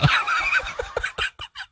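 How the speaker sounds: very high helpless laughter. Real, not acted. Streamer teased to the breaking point, high-pitched laughing. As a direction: crack up in a high squeal. Driven crazy by teasing.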